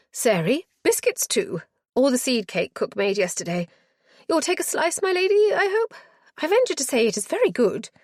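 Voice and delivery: put-on high, fluttering, flustered old matron. Matron greeting her lady visitor, high and flustered. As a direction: gush over the guest in a high flustered voice. Matron calling after maid.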